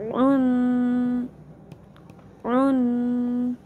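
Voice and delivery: slow drawn-out spelling drill; recitation drill. Shown as a demonstration. Quran teacher drilling students through the letters slowly. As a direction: stretch each syllable with nasal ghunna.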